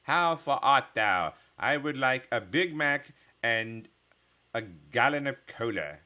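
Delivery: loud, high, slow olde-English roleplayer; mock roleplayer. Streamer mocking Warcraft roleplayers to chat, hammed-up olde-English declaiming. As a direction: declaim grandly like a game roleplayer ordering fast food. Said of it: "not roleplay. Roleplay, that's it".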